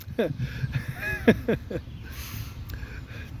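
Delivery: long, soft laugh; amused laugh. Real, not acted. Reader breaking up after squeaky mouse voice, soft laugh. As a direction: giggle quietly at your own silly voice.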